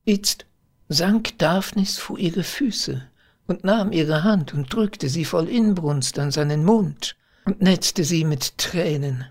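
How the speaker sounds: ordinary delivery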